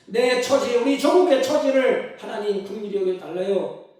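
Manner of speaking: loud, pleading cry to God; a desperate, loud prayer for mercy. Acted.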